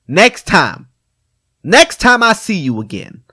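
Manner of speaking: loud, high stern warning; hammed-up cop threat. Officer warning kid before release, stern mock threat.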